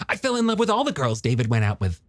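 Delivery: quick, high, eager character voice; chatty younger brother. Acted. Young man recalling his boyhood crush to her, quick high voice.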